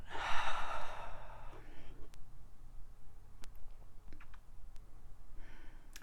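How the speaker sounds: long audible exhale, unvoiced; long sigh out. Shown as a demonstration. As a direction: let the breath go in one slow sigh. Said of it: "Sigh it out".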